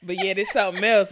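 normal talking voice

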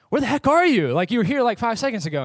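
half-panicked, half-annoyed, the voice up high; exasperated call. Retold or imitated. Calling wife after losing her.

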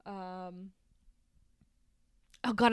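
normal talking voice

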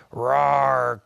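a loud playful lion roar. Acted. Parent telling a bedtime story to Bud, loud playful lion roar. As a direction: roar it out big like a friendly lion. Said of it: "the lion will say".

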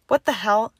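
loud, alarmed question; scared outburst. A narrator's reading. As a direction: burst out in frightened protest. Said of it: "her voice quaking and scared".

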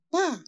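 sharp high pa for the sling stone; mock sound effect of a stone striking; stone impact. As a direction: snap out a quick high hit sound. Stone hits Goliath.

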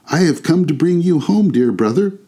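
put-on high, eager child voice; delighted little girl. A narrator's reading. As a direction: announce it as a joyful little sister. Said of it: "bending down to laugh".

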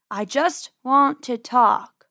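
slow, loud, deliberate lure; drawn-out false reassurance; coaxing farmer. A narrator's reading. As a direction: draw each word out to coax them. Farmer lures the rabbits out.

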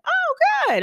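high chirpy "Oh, good."; bright reply. Retold or imitated. Replying to a stranger while walking on, high and chirpy. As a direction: answer cheerfully on the move. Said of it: "pause and wait for you to respond".

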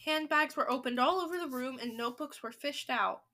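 ordinary delivery

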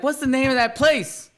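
high, loud, urgent repeated question; racking the brain out loud; mock frustrated recall; frantic searching. Retold searching for a name.

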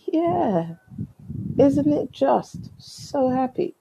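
high, drawn-out mock delight; sarcastic cheer. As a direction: drag out the fake happiness. Sarcastic reply about ruined birthday.

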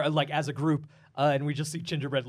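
ordinary talk

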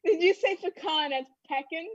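first "pecan" puh-KAHN, second "pecan" PECK-in; two versions of "pecan" set against each other; contrasting pecan pronunciations. Shown as a demonstration. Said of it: "you could say pecan or pecan".

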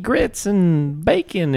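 hammed-up Southern pandering; fake Southern accent. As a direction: lay on a phony Southern twang. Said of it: "she tries that Southern accent".

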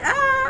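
high shouted shriek of disgust. Retold or imitated. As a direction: shriek like you saw something awful. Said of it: "I was like".

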